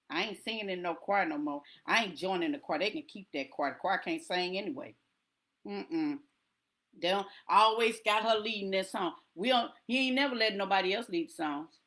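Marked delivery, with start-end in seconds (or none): 0.1-9.1 sulking member. Retold or imitated. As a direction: sulk about the choir.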